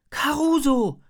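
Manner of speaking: high, loud cry of recognition; Hannah, calling out. A narrator's reading. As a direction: shout the name in surprise. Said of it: "ruft Hannah". (laut und hoch gerufen)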